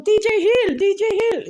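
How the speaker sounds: very high, loud hollering; Eddie Murphy. Retold or imitated. Comedian waltzing in again, hollering the name high and loud. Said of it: "Eddie Murphy comes waltzing in again".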